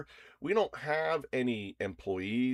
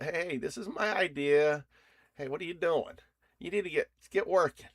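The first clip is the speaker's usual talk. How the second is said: a pushy boss, pitched higher; playfully nagging the little workers; higher, mock-bossy manager voice; 7 semitones higher than usual. Voicing boss ordering agents around.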